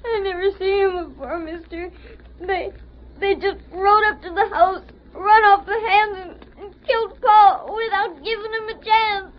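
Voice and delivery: put-on halting, crying boy; tearful account. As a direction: stammer it out through sobs. Sobbing boy tells of killing.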